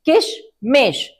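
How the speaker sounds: किशमिश split as किश and मिश, said slowly; broken into parts. Shown as a demonstration. Teacher showing children how to split a long word, slowly. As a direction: break the word in two. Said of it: "तोड़ तोड़ कर बोल सकते हैं".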